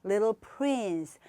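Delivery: little prince slowly; little prince, slow demo; slow model word. Shown as a demonstration. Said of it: "그다음에".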